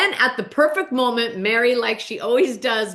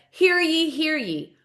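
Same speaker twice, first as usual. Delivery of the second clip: higher, sing-out mock proclamation; town crier; 6 semitones higher, slower than usual. [name] calls court into session, proclaiming like a crier. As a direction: call court to order, first call high. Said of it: "calls court into session".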